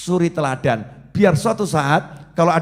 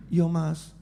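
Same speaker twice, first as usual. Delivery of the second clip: meek fear; 9 dB quieter than usual. Retold or imitated.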